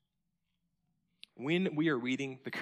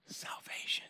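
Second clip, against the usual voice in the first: breathy hushed whisper; awed whisper of salvation; 10 dB quieter than usual. Acted. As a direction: let the word fall to a whisper. Simeon's words, awed quote.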